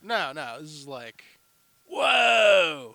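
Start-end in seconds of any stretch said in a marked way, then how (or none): 1.9-2.9 loud, drawn-out falling whoa; higher, stretched-out mock amazement; mind-blown whoa. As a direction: play mock awe, long and loud. Acting out a mind-blown reaction.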